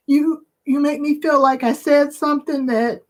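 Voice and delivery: overcome with emotion. Real, not acted. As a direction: voice climbing high toward tears. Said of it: "I don't even know what's wrong with me".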